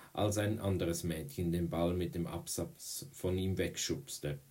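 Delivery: usual speaking voice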